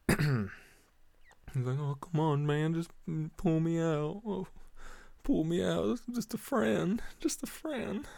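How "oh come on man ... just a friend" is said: high, wheedling mock plea; imitated pleading drunk. Imitates drunk man pleading for help.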